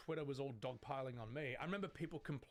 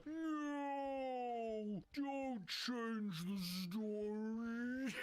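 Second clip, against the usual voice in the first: high, long-drawn wail of mock objection; mock protest; 8 semitones higher, much slower than usual. Mimicking objectors to story changes, mock wail. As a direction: stretch each word out high, laughing after. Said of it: "oh, just being like".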